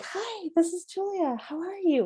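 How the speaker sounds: high, sweet professional greeting; honey voice. Shown as a demonstration. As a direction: put on the polite phone greeting. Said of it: "It's our honey voice".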